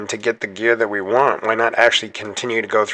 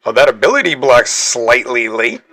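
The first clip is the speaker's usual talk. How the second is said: ordinary talk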